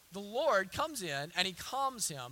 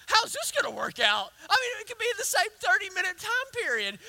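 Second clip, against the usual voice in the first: mock panic; 11 semitones higher, 7 dB louder than usual. Acting own panic after praising God.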